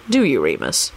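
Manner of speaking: low, softened challenge; impassive. A narrator's reading. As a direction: ask it impassively, pitched low. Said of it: "he commented impassively".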